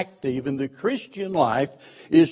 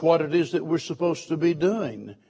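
usual speaking voice

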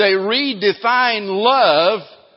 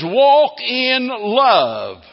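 preached slowly and loudly; 5 semitones higher, 5 dB louder, much slower than usual. Acted. Preacher repeats text, louder.

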